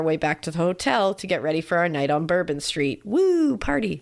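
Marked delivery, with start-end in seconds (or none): 3.0-4.0 a little sarcastic party whoop; mock party cheer. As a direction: fake whoop of excitement. Mock cheer for Bourbon Street.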